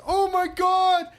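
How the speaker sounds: very high, loud, slow excited yell; excited shout. Retold or imitated. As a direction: blurt it out thrilled. Todd excitedly shouting at cosplayer.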